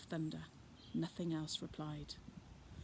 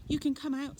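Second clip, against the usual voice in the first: higher, louder coaxing call; reassuring call; 8 semitones higher, 5 dB louder than usual. Acted. Fred coaxing the children out of the bushes, coaxing call. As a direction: coax them out gently. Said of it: "said Fred".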